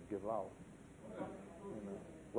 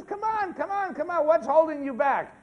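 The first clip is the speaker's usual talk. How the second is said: pushy chicken; 10 semitones higher, 6 dB louder than usual. Acted. Chicken coaxing salmon again.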